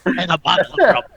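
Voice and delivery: rowdy pirate boast. Pretend. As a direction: say it like an old sea dog. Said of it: "I'm just talking like a pirate".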